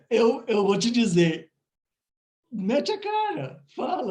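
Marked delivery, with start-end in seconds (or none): 2.5-4.1 emphatic push. Real, not acted. Host urging the guest to just speak up, high and emphatic.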